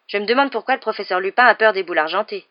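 plain reading voice

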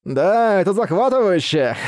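a bright burst of delight; excited. Acted. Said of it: "восхитился".

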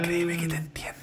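mock-secret whisper, voice dropped to breath. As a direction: lean in and whisper it like a confession. Joking aside about busy schedules.

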